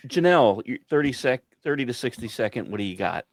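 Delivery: ordinary talk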